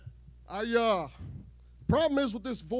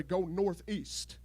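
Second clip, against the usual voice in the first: dead serious; 3 semitones lower, 6 dB quieter, much slower than usual. Retold or imitated. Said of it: "look at you dead serious and say".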